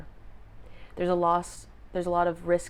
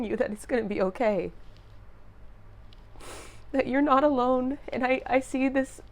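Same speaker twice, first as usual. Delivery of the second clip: higher-pitched, choked-up and moved; real emotional, near sobbing; 5 semitones higher than usual. Real, not acted. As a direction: fight back real tears as you speak. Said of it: "I feel like sobbing".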